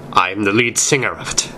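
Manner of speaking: ordinary delivery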